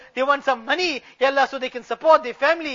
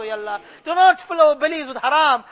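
usual speaking voice

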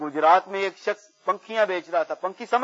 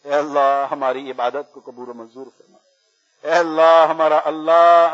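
prayer supplication; 2 semitones lower, 6 dB louder than usual. Real, not acted. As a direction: implore Allah on the congregation's behalf. Leads supplication in Urdu. (التجا بھرے انداز میں دعا)

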